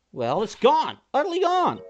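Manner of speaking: high, loud, exasperated announcement; agitated outburst. Acted. Announcing the body is gone, agitated and high.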